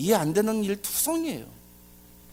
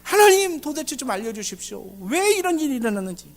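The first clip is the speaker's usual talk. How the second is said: earnest pleading; 7 semitones higher than usual. Acted. Elijah before God asking why this happened, earnest pleading. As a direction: beg God earnestly for an explanation. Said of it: "간절히 목소리 내서 기도했다".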